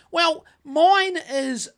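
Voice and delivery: slow, deliberate explanation at the counter; slow, pointed retelling of his reply to the cashier; slow retold reply. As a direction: spell it out slowly to the cashier. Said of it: "So I said to her".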